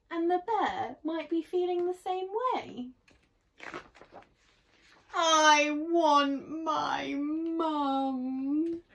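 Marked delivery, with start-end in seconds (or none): 5.1-8.8 put-on slow, drawn-out child's wail. A narrator's reading. Frightened boy wants his mother, wailing. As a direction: whine it long, like a scared boy.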